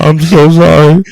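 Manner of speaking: loud, high, hammed-up pleading; mock tearful apology. Mocking himself apologising to his girlfriend, hammed-up loud plea. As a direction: overact gripping the mic, begging forgiveness. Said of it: "double gripped on the mic".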